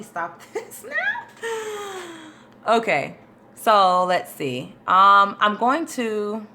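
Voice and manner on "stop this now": very high, drawn-out fake whine; hammed-up squeaky sob; mock wail. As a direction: whine it out slowly, pretending to cry. Mock wail as it gets dark.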